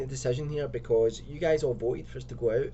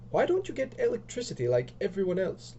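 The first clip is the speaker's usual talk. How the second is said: higher-pitched, mildly angry complaint; 8 semitones higher than usual. Acted. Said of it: "Lisa sounds really angry".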